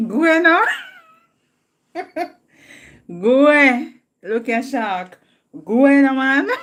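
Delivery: loud, high calls of go; cheering shout. Real, not acted. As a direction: egg him on with a loud yell. Cheering on a viewer, calling out.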